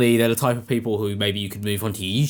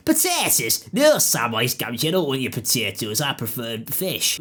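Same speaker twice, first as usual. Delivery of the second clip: Gollum. Acted. Creature refusing Sam's food, hammed-up impression. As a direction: squeal the first word, then drop into a creature voice. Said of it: "This is my Gollum".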